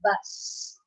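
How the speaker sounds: soft whispered buzz; breathy bee buzzing. Shown as a demonstration. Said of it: "what is the sound of a bee".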